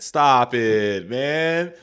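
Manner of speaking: firm, loud stop it, man; a loud, fed-up stop it. Real, not acted. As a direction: shut the idea down firmly. Reacting in disbelief to the policy.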